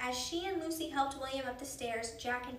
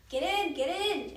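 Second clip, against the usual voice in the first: loud, slow, high command; drawn-out urgent bellow; shouted order; 5 semitones higher, 7 dB louder than usual. A narrator's reading. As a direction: order passengers aboard with a shout. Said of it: "a uniformed man shouted".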